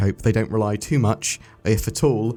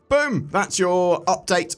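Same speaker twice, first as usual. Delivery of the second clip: excited; 8 semitones higher, slower than usual. Real, not acted. Excited exclamation ending update.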